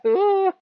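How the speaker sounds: high, loud mock ooh; high, loud playful ooh. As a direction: squeal it out like a cartoon. Playful reaction sound.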